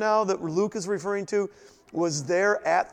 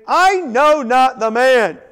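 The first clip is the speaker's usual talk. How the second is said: loud, higher, slow and forceful; cursing denial; 6 semitones higher, 11 dB louder, slower than usual. Acted. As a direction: hurl the denial out as a curse. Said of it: "Then began he to curse and to swear".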